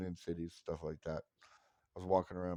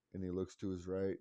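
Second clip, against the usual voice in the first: normal talking voice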